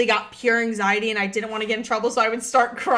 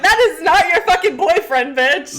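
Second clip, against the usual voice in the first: mock outraged yell; 5 semitones higher, 5 dB louder than usual. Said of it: "I was like".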